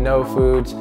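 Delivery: ordinary talk, nothing marked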